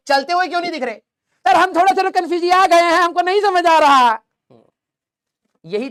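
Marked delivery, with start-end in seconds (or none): 1.5-4.2 higher, louder whiny student voice; confused student. Acted. Teacher mimicking his confused student, high and whiny. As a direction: play a baffled kid begging sir.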